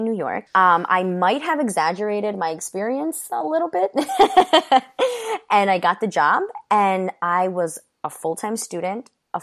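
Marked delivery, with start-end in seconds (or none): 4.0-5.5 a burst of high laughter; sudden high laugh. Real, not acted. Laughing as she admits to stretching her waitressing experience. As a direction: laugh out loud at your own cheek.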